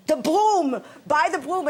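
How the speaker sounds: high, loud mother impression; broom-obsessed mother. Mother brushing past aunt's death, loud mock insistence. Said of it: "And she said".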